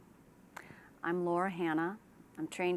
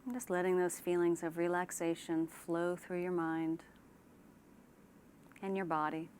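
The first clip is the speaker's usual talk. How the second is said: unhurried relaxation-exercise delivery; guided meditation; 4 dB quieter than usual. Shown as a demonstration. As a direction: ease them gently into calm, leaving space after each phrase. Leads a guided imagery relaxation.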